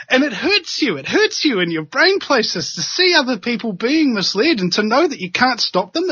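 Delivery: ordinary talk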